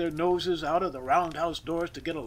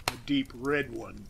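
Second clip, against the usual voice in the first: ordinary delivery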